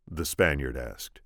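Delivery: plain reading voice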